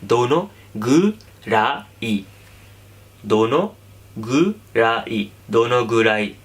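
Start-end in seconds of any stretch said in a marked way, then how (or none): 0.0-2.2 slow drill. Shown as a demonstration. Teacher making students memorise the core word, slowly. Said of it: "इस word को याद करना है". 3.3-5.3 दोनों, a pause, then a very slow गुराई; split slow drill. Shown as a demonstration. Teacher splitting the word for students to repeat, slow. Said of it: "इस word को याद करना है".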